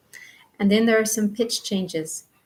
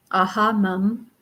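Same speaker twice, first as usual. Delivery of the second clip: normal talking voice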